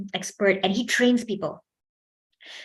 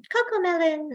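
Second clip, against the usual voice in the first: put-on higher, sing-song voice for children; high kids' voice; 8 semitones higher than usual. Shown as a demonstration. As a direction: pitch it up like talking to toddlers. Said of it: "delivering to kids ... higher pitch".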